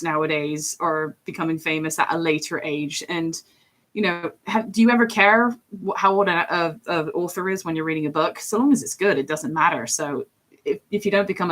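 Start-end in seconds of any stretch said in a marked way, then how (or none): none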